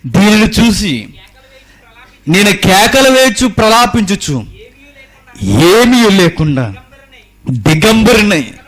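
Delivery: slow, loud, high wailing lament; prophet's lament. Acted. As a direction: draw out the verse like a mourning prophet. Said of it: "ఏడుస్తున్నాడట". (నెమ్మదిగా, గట్టిగా విలపించే ప్రవక్త స్వరం)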